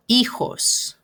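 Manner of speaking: "Hijos" as EE-hos, silent first H; "Hijos" with an airy H sound in the middle; model pronunciation. Shown as a demonstration. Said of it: "If you can please pronounce that".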